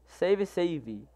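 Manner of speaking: quiet echo of the chat. Shown as a demonstration. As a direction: drop the volume on the echo. Reading chat aloud, repeats.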